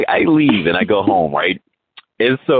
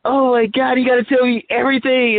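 high, perky impression of an eager wife; imitating chippery wife; 12 semitones higher than usual. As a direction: chirp it like a wife wanting all the gossip. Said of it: "she's chippery".

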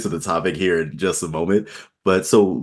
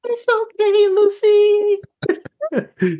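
much higher voice. Retold or imitated. Narrator comforting his partner in a high-pitched voice. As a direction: own reply squeaked up high. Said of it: "Speaking in a high-pitched voice".

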